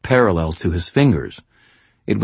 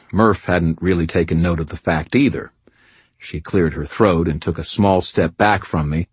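ordinary delivery

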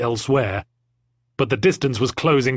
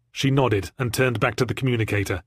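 usual speaking voice

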